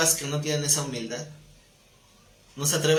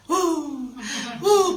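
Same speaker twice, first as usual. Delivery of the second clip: high, rising siren-like "uh, uh" wail, louder; mock a child's fake tantrum crying, like a siren; tantrum wail imitation; 12 semitones higher, 4 dB louder than usual. Shown as a demonstration. Pastor mimicking his daughter's tantrum, siren-like wail. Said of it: "cuando llora de berrinche le hace".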